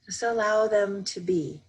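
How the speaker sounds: slow, even meditation voice with long pauses; calm, measured yoga nidra guidance; guided meditation. Real, not acted. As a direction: guide them gently into stillness, unhurried.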